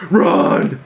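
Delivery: mock yell. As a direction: imitate Coral's urgent yell, held back a little. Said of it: "Coral then yells at May".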